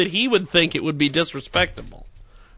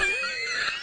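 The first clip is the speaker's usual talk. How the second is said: burst of laughter; 13 dB quieter than usual. Real, not acted.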